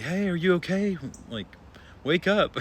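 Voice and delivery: high, loud calls to wake him; loud urgent wake-up call. Retold or imitated. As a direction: shout to rouse an unresponsive man. Trying to wake unconscious man.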